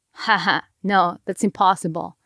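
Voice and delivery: normal talking voice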